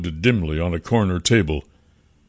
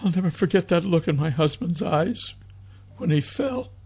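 high, halting woman's voice; grieving widow close to sobbing; tearful grief; 10 semitones higher, 4 dB quieter than usual. A narrator's reading. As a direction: let the grief lift the voice high. Mother sobbing over dead husband.

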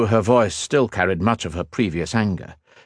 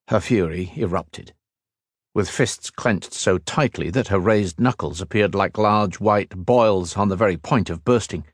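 plain reading voice

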